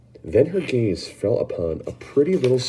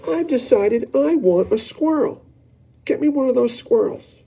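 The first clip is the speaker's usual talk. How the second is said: a brat insisting on getting her way; spoilt Veruca; 4 dB louder than usual. Acted. Veruca demanding a squirrel from her mother, high and whiny.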